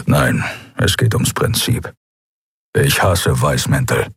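low, breathy, slow growl of contempt; surly creature voice. A narrator's reading.

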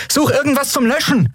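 very high, pressed cry; urgent call. Acted. Endriel ordering help against the fire, high urgent call. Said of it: "rief Endriel".

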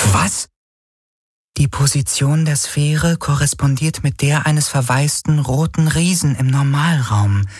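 a device breaking bad news, starting hushed; Schattenhelix voice. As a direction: Schattenhelix warning Garland about the inferno, apologetic machine voice. Schattenhelix explains the danger.

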